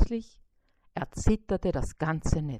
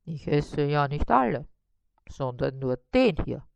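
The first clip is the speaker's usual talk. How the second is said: stubborn spider; 5 semitones lower than usual. A narrator's reading. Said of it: "sagte Karl-Heinz".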